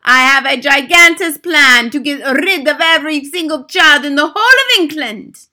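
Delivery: high, loud commanding bark. Acted. Grand High Witch barking her plan at the witches, loud. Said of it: "barked the Grand High Witch".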